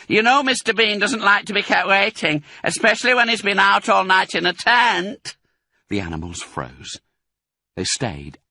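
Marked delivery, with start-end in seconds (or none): 0.1-5.4 high, loud bossy call; calling from upstairs. Acted. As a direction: nag her down the stairs. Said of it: "the voice called".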